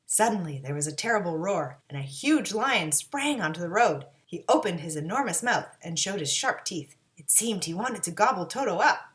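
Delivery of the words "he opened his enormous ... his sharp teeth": usual speaking voice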